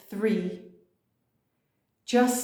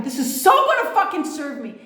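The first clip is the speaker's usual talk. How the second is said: upbeat declaration; 6 semitones higher, 4 dB louder than usual. Shown as a demonstration. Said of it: "declare it".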